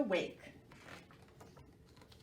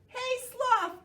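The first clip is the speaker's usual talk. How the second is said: high, loud call across the trees; 6 dB louder than usual. Acted. Monkey calling Sloth from the bars, high and loud. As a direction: call up to him like a cheeky monkey. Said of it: "called Monkey".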